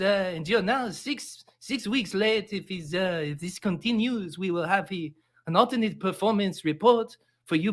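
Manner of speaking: badly faked French accent, stern disappointed boss; awful French accent. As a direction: scold an employee in a bad French accent. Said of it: "That, that French accent was awful".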